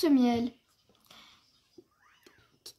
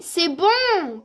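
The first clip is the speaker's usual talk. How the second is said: louder, with a big swooping stretched last word; delighted exclamation; 3 semitones higher, 9 dB louder than usual. Acted. Honey bear cub tasting the snack, delighted exclamation.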